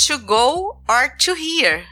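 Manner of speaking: very slow, over-articulated English; slow it right down as if for a tourist; slow clerk. Retold or imitated. Clerk repeating slowly for foreigners.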